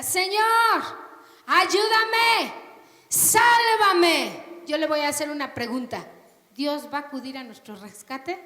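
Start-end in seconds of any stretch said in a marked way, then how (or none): 0.0-4.6 loud, high plea for help; crying out. Acted. A believer in trouble crying out to God, loud and high. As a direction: cry out to the Lord for rescue. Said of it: "uno grita".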